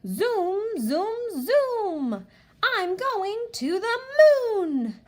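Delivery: high, loud and slow moon line; thrilled to go to the moon; fun read-aloud. Shown as a demonstration. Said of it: "use inflection in our voice and make it sound so fun".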